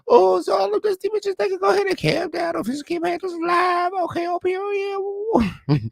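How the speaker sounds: high falsetto, shouted sass; high sassy shout. Acted. Host mocking dusty haters, high sassy shout. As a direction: shout it in a shrieking diva voice.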